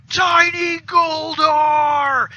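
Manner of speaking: high, drawn-out mock-anguished shout; anguished Goldar. Goldar sees his figurine broken, long hammed-up cry. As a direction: wail it out loud, hugely hammed-up. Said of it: "he fucking loses his shit".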